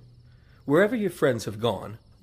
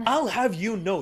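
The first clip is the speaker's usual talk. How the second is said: huffy rebuke; 8 semitones higher than usual. Acted. Butler scolds the young lady.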